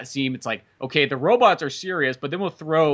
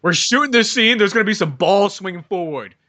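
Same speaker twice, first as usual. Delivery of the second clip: mock pompous director; 3 semitones higher, 5 dB louder than usual.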